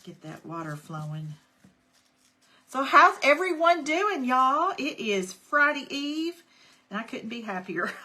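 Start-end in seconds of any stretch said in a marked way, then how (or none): 2.7-6.4 much higher and louder, eager hello; bubbly check-in. Real, not acted. Host asking live viewers how their week went, bright and loud. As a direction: beam out a question to the crowd.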